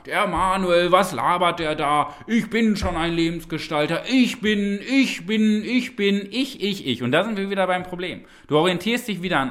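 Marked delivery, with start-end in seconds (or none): 0.1-7.0 nörgelndes Ego imitiert. Acted. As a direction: mimic a self-centred ego, repetitive and insistent. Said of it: "könnte wieder dein Ego sagen". (gespielt empörtes Ego, immer eindringlicher)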